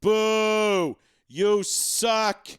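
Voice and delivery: loud drawn-out jeer; mock heckler's call; heckling boo. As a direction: boo like a fan behind the dugout. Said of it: "and I'm going".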